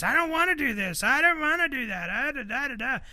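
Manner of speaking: put-on high whiny little-kid whine; whiny toddler. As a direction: mimic a sulky toddler griping. Mom retold mocking whiny toddler.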